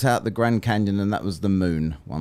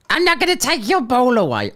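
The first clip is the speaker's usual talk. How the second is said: much higher and louder, a mock whining kid; mock childish voice; 9 dB louder than usual. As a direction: taunt the trolls like a sulky child. Mocking trolls as childish.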